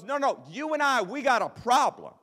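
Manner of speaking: 气势汹汹地坚持; high, loud combative insistence; angry insistence. Retold or imitated. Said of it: "He goes".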